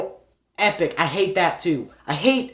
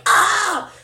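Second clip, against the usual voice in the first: short high mock scream; mock kid scream; 5 dB louder than usual. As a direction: shriek like a hyped kid. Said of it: "when you scream during it, too, you're going like".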